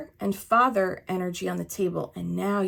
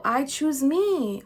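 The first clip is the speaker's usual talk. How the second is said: affirmation; 7 semitones higher, 4 dB louder, slower than usual. Shown as a demonstration. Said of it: "you are choosing you".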